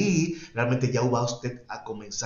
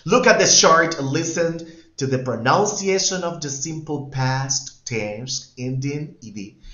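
instruction read; 3 semitones higher, 5 dB louder, slower than usual. Shown as a demonstration. Teacher reading English instructions to students, slow and clear. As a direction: read the task out to the class clearly.

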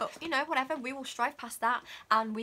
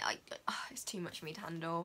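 overwhelmed whisper; 4 semitones lower, 7 dB quieter, faster than usual. Real, not acted. Overwhelmed by the palette.

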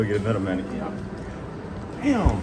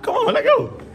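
usual speaking voice

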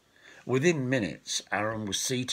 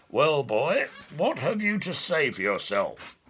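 a lightly voiced stern king, a touch louder; stern elf king; 7 semitones higher, 4 dB louder than usual. Elf king interrogating the captured boy, stern and cold. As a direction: question the boy like a cold monarch. The elvish king questions a prisoner.

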